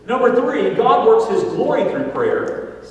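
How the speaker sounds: loud, high, slow preacher's cry; full-force preaching. Acted. Preacher driving home points to his congregation, full-force preaching. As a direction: proclaim each point to the church.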